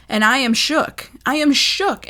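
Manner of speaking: high, loud, shaken emphasis; emphatic shock. Real, not acted. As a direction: punch it out, stunned and insistent. Reliving her shock at the news.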